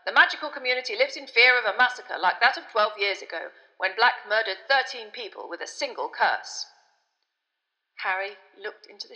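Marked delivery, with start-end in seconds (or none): 0.1-6.7 loud, projected news-reading voice; newspaper report read aloud. A narrator's reading. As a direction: announce it like an urgent bulletin.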